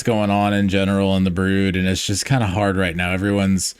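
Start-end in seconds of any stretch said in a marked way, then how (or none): none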